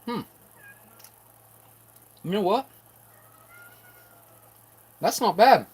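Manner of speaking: like the taste caught you off guard; pleasantly surprised. Real, not acted. Tasting food, pleasantly surprised.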